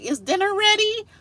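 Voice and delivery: high mocking "Is dinner ready?"; mocking bland question. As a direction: squeak it out like a shallow chat line.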